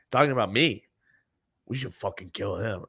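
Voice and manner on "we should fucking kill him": soft conspiratorial aside; imitating a quiet threat; hushed plotting. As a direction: say it under your voice to an accomplice. Passenger plots quietly behind the driver.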